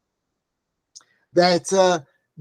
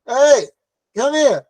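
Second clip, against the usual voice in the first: mock indignant call, higher pitched; playful raised-voice hailing; 4 semitones higher, 5 dB louder than usual. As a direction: call someone over as if claiming what's yours. Acting out calling to Bentley driver.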